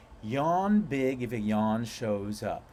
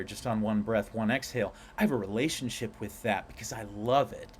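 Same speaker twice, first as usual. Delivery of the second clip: usual speaking voice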